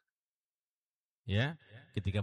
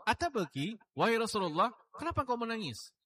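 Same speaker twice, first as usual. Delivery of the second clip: higher, puzzled voice of a man asking the Prophet; a man asking the Prophet why he weeps, higher and puzzled; questioner's plea; 12 semitones higher than usual. Retold or imitated. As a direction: ask it high and bewildered, lightly voiced. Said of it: "kepada beliau oleh seseorang".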